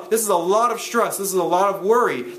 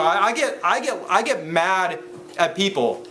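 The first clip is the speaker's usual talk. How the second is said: ordinary talk, nothing marked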